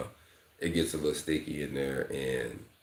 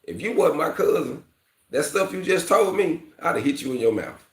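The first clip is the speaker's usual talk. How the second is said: higher, louder warning from a tough big cousin; cousin's stern threat; 12 semitones higher, 5 dB louder than usual. Retold or imitated. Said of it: "He said".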